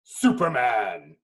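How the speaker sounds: usual speaking voice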